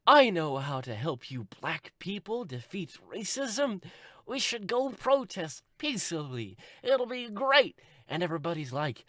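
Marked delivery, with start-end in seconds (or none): 0.1-7.8 high, slow, naively cheerful; hammed-up earnest do-gooder; Tracy. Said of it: "And Tracy's like".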